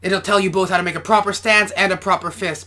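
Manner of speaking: usual speaking voice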